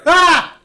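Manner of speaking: loud, high "Ah!"; sudden scream. Acted. Game master voices a cry heard under the bed, sudden loud scream. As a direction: burst out with a startling yell.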